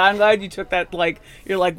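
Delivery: ordinary talk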